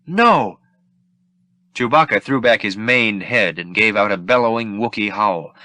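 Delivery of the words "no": a high, loud anguished cry of No; anguished protest. Acted. Leia protests as Han is sent to be frozen, high and anguished. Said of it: "Anguished, Leia protested".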